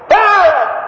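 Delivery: desperate shout to heaven; shouted command. Acted. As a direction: demand it at God. Crying out to God.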